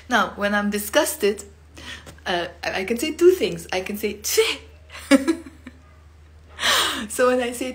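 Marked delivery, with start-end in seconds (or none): 4.1-4.5 "Tfih" spat out sharp and high; spitting tfih. Shown as a demonstration. Teacher shows the disgusted spitting sound "Tfih". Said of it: "a spitting sound".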